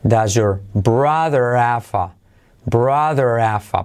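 "brother have a" slowly linked as brother-ava; slow, blended brother-ava; dropped H. Shown as a demonstration. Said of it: "se cae también".

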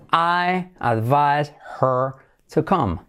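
very slow, separated words. Shown as a demonstration. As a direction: model the question slowly for learners. Slow model, then linked take. (muy lento, palabra por palabra)

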